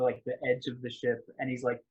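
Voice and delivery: ordinary talk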